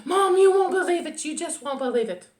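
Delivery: high, loud, bursting with news; thrilled young turtle voice; excited. A narrator's reading. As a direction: blurt it eagerly to Mom. Franklin bursts with his news.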